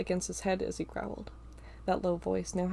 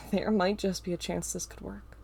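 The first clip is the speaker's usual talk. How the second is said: ordinary delivery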